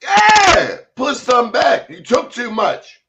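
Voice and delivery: loud, high scolding order; stern shout. Retold or imitated. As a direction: bark it like an angry auntie. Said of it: "she said".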